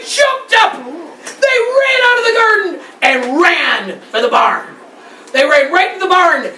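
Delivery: high, loud, breakneck excitement; excited narration. A narrator's reading. Storyteller describes the goats fleeing the bee, excited. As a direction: tell the escape at full tilt.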